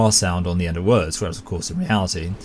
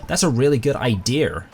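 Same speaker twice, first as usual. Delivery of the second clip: higher American voice, "idea" ending in R; mock American accent; 5 semitones higher than usual. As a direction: parody an American saying "idea" as "idear". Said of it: "make fun of my wife, Hannah's accent".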